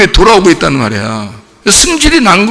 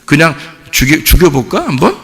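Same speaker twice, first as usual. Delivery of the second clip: lower, offhand suggestion; casual musing; 3 semitones lower, 3 dB quieter than usual. Acted. Preacher mocks a casual plot against Jesus, offhand musing. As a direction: toss it out as an idle idea. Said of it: "이래서 죽인 거 아니에요".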